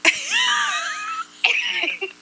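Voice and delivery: long, very high-pitched laugh; high squealing laugh. Real, not acted. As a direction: burst into a piercing squeal of laughter. Laughing at her own roommate line.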